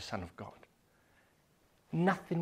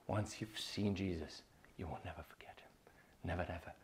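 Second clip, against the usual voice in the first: unvoiced whisper, much softer; 7 semitones lower, 13 dB quieter than usual. Real, not acted. Preacher promising the listeners, whispered. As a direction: breathe it close.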